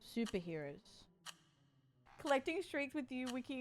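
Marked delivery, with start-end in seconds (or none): none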